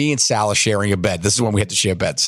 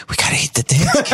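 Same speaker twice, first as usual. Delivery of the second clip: gleeful decision; 13 semitones higher than usual. Retold or imitated. Mischievous decision, laughing.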